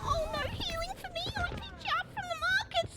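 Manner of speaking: playful high singsong; silly voice. Acted. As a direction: whine it in a put-on high voice. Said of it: "I don't know why I'm talking like that".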